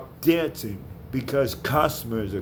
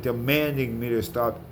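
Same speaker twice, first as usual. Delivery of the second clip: ordinary talk, nothing marked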